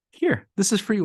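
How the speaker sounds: high, play-acted sweet offer; put-on friendliness. Giver to poor person, wanting rid of it, put-on friendliness.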